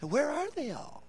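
soft, muttered mock counting; mock counting critic. Preacher imitating a critic counting converts, mocking. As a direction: tick them off like a skeptic counting. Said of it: "you're back and going".

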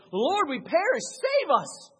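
high, loud, desperate cry for rescue; a loud high frightened plea; panicked plea. Retold or imitated. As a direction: beg to be saved from drowning.

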